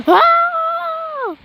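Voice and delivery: high, drawn-out mock scream. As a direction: scream as if swept away. Playing at disaster, screaming.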